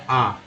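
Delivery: आ as an open standalone vowel; the A of salt said as आ; vowel sound demo. Shown as a demonstration. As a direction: sound out आ on its own. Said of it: "सॉल्ट में ए को क्या बोला गया".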